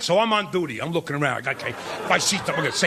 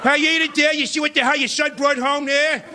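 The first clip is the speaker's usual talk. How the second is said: put-on drunk Archie Bunker; 3 semitones higher than usual. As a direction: ham up a tipsy bigoted father. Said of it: "drunk Archie Bunker ... he does this".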